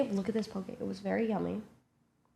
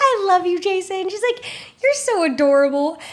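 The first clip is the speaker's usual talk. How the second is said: much higher, louder doting coo; doting drunk mom; 10 semitones higher, 5 dB louder than usual. Retold or imitated. Drunk mom telling Jason she loves him, high loud coo. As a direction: gush it like a tipsy mom.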